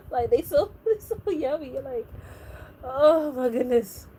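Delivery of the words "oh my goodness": slow, drawn-out oh my goodness; dreamy slow sigh of delight; slow swoon. Real, not acted. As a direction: swoon over the actors. Swooning over vampire actors.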